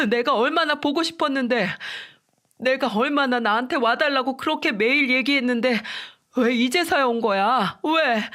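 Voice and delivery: high, wailing cry to lost parents; sobbing, pleading. A narrator's reading. Husband sleepwalking, crying to his dead parents, wailing. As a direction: wail it out like a lost child. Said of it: "남편은 거의 포효하듯 울면서 이야기를 했고".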